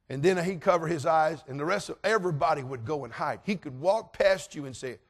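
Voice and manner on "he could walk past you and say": ordinary delivery, nothing marked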